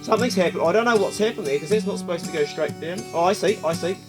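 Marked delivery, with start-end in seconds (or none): none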